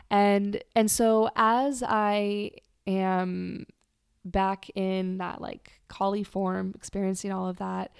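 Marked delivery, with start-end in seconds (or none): none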